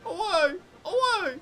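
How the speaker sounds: high hammed-up shout of away; mock enemy yell. Streamer copying an enemy's battle cry, hammed-up shout. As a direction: bellow it like a game enemy. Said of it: "my favorite Bloodborne quote".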